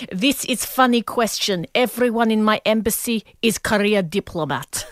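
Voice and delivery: deliberate mock-Russian accent, brushing off the question; Russian ambassador accent. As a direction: do a central-casting Russian diplomat accent. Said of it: "So he sounds like".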